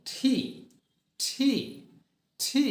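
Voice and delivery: ঠি, said with a puff of breath; aspirated tee. Shown as a demonstration. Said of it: "টি না বলে আমরা কি বলবো". (বাতাস ছেড়ে ঠি)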